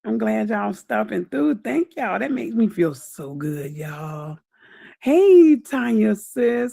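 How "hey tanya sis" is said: loud hey. Real, not acted. Greeting Tanya joining.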